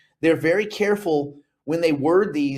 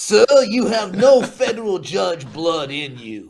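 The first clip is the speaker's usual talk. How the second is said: loud, slow mock-judge scolding; booming put-on judge accent; 3 semitones higher, 6 dB louder, slower than usual. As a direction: pass sentence like an indignant judge. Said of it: "that's a judge accent".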